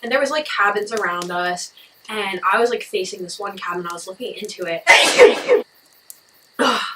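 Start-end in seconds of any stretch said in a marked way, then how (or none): 4.9-5.6 sneeze. Real, not acted. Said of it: "I love when I dab when I sneeze".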